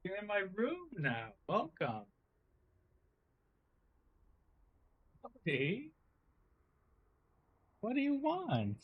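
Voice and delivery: soft, high coaxing voice; cat talk. Real, not acted. Player greeting the cat in the room, cooing softly. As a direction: coo gently up high to a pet.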